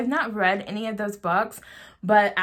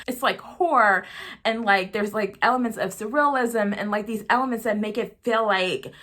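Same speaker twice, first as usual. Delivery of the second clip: usual speaking voice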